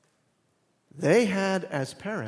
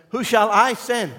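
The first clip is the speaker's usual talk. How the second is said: raised, louder call as God; booming voice of God; 7 semitones higher, 6 dB louder than usual. Acted. Preacher voicing God, loud and raised. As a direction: proclaim it as God calling out. God's voice quoted in sermon.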